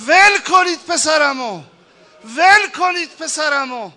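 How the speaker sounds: loud, high cry to let the son go; father shouting at the women to release his son; father's shout. Acted. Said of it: "داد زد به زنا".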